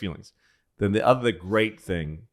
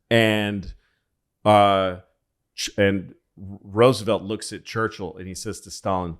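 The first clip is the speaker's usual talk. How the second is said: ordinary delivery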